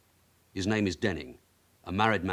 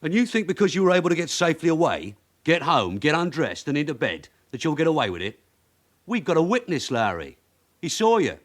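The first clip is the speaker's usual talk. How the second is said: louder, higher, building pressure; pressing hard; 7 semitones higher, 5 dB louder than usual. Acted. Detective hammers the suspect with the witness, loud and higher. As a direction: lean on him until he cracks.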